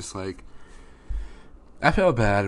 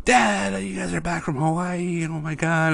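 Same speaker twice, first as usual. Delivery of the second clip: put-on much higher, squealing kid delight; excited daughter; 9 semitones higher than usual. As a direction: gush like a little girl seeing Dad again. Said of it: "she was so excited".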